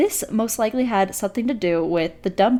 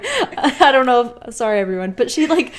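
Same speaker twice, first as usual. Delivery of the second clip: laughing while telling; 6 semitones higher, 8 dB louder than usual. Real, not acted. Laughing through the story.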